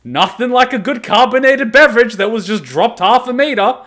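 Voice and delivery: high, loud, over-the-top sarcastic cheer; mock enthusiasm. Real, not acted. Ranting that dropped soda cans fizz over, loud mock delight. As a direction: sell the fizzy-can disaster with fake delight.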